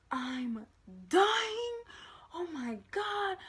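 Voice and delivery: high, drawn-out mock panic; freaking out. Panicking over search results, hammed-up high freak-out. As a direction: wail it high, loud on "dying". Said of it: "I'm freaking out".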